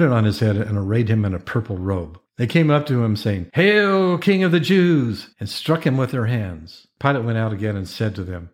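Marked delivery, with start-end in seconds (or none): 3.5-5.3 high, drawn-out hail; mock acclamation. A narrator's reading. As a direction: proclaim it high, like soldiers saluting a king. Soldiers mocking Jesus in scripture reading.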